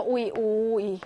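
오이 said twice, clear and drawn out; pronunciation demo. Shown as a demonstration. Teacher modelling the eu sound as 오이. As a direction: model the vowel 오이 for the class. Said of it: "오이로 발음하죠?".

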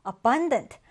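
"abundant" stressed on -BUN-; stress on second syllable. Shown as a demonstration. Teacher reads out a harder word, "abundant" with second-syllable stress. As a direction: hit the middle syllable of "abundant". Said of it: "두 번째 음절에 강세를 두고요".

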